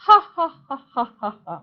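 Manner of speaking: booming mock laugh; loud lion laugh. The lion laughs at the tiny mouse, in a loud mock laugh. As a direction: laugh it off like a big proud lion. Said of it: "laughed the lion loudly".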